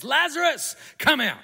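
acted shout to summon someone out; Jesus shouting. Calling Lazarus out of the tomb, acted high shout. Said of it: "Jesus shouts".

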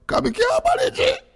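high laughing squeal. Real, not acted. Laughing hard on air.

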